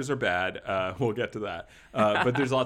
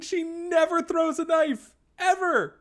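exasperated; 5 dB louder than usual. Real, not acted. Exasperated rant.

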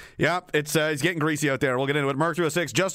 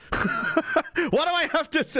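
laughing; 12 semitones higher, slower than usual. Real, not acted. Laughing at viewer's offer.

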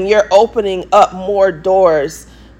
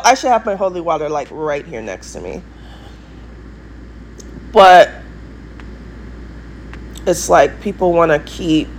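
ordinary delivery

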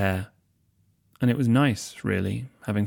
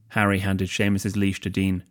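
ordinary delivery, nothing marked

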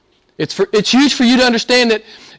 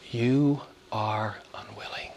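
lower, whispered, much softer; 9 semitones lower, 13 dB quieter than usual. Real, not acted. Preacher whispering Jesus' words to the religious. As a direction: hush it like a verdict.

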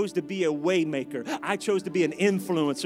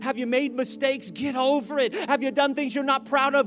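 higher and much louder, hammering "Get over it"; full-force preaching; 6 semitones higher, 6 dB louder than usual. Acted. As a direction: build each "Get over it" to a shouted peak. Preaching climax, repeated refrain.

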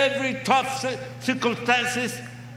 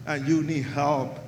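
low, soft invitation to pray; soft altar call; 6 semitones lower, 5 dB quieter than usual. Real, not acted. Preacher softly inviting the crowd to pray, low and soft. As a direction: coax them gently toward prayer.

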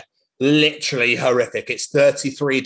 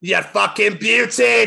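cheering; 6 semitones higher, slower than usual. Acted. Peppa and Susie cheer in Scottish reading. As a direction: cheer it out triumphantly. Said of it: "cheered Pepper and Susie".

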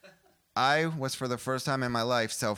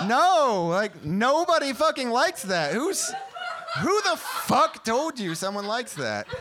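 high, loud comic outrage; indignant outburst; 11 semitones higher, 4 dB louder, slower than usual. Acted. Comic answering a bad lover's question, loud high indignation. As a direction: protest in exasperated disbelief, voice pitched way up.

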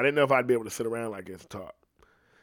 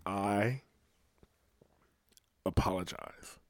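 ordinary talk, nothing marked

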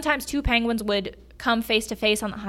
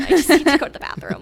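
exasperated plea; 6 semitones higher, 6 dB louder, faster than usual. Retold or imitated. Retold plea to terns.

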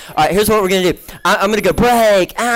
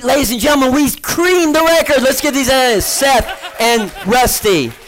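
excited announcement; 7 semitones higher than usual. Real, not acted. Announcing the record was beaten.

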